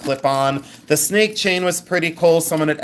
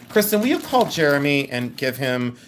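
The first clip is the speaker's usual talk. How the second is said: normal talking voice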